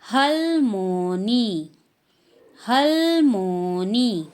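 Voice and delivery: "हलमोनी" very slow, syllable by syllable; teacher modelling Korean "हलमोनी"; Korean word. Shown as a demonstration. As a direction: model "हलमोनी" slowly twice. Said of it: "grandmother को".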